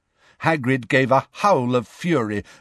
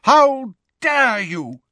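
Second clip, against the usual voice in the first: slow, high outraged shout; yelling while running; 12 semitones higher, 4 dB louder, much slower than usual. A narrator's reading. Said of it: "the figure shouted as she ran".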